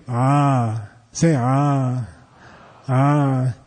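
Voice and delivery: low, drawn-out 'ah' from the voice box. Shown as a demonstration. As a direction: let 'ah' vibrate low in the throat. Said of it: "It's vibrating here".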